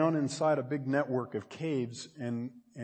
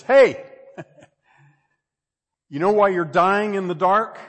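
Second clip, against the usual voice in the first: loud, high, laughing taunt; mocking echoing voice; 6 semitones higher, 15 dB louder than usual. A voice in the cave taunts the lost caver, loud and mocking. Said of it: "and it says".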